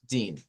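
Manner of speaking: Din falls low and soft; unstressed ending Din, low; dropped last syllable. Shown as a demonstration. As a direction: let Din sink down quietly. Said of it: "Y luego bajamos".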